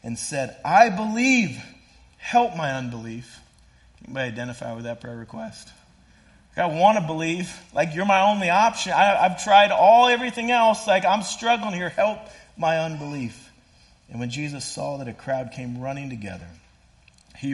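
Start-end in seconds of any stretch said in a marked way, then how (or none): 0.7-3.4 high, loud desperate cry; a father's anguished plea. Acted. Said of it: "the father cried out". 6.6-13.5 loud, high, pleading prayer; loud desperate paraphrased plea. Retold or imitated.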